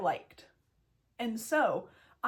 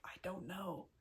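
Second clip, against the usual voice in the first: hushed, barely voiced confession; quietly admitting I don't know; whispered; 4 semitones lower, 14 dB quieter than usual. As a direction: drop to a near whisper, sheepish. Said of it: "And I was like".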